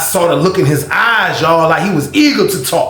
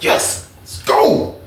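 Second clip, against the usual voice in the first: high, drawn-out hushed cheer; psyched, but holding the volume down; hyped up; 3 dB quieter, much slower than usual. Retold or imitated. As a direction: celebrate in a strained, airy squeal under your breath. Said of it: "I'm hyped in the room".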